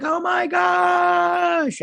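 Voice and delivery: very high, long drawn-out squeal; stretched-out excited scream; fangirl squeal. Retold or imitated. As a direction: squeal it slowly like a thrilled fan. Replays own fan reaction.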